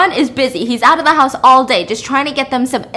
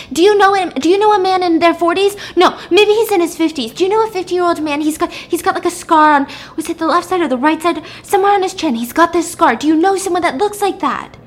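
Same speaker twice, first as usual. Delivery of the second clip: frantic questioning; 7 semitones higher, 4 dB louder than usual. Retold or imitated. Susie frantically questions girl.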